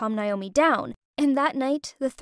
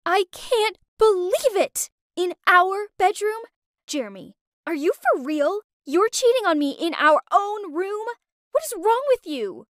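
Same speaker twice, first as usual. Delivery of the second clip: angry; 7 semitones higher than usual. Acted. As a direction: rage at a cheating boyfriend. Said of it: "She was super angry".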